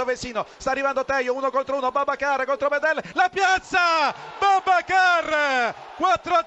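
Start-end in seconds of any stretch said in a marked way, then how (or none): 3.1-6.4 slow, loud stretched goal cry; drawn-out goal shout. Real, not acted. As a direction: stretch out the scorer's name. Goal call in live commentary. (urlo del gol allungato)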